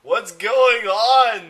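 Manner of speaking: hammed-up villain shout. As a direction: bellow it in alarm. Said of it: "the bad guy shouted".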